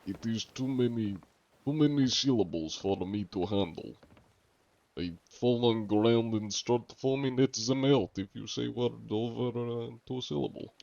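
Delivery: hammed-up Russian speaker in broken English; Russian accent. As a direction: keep up a comic Russian accent. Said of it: "started going Russian for that".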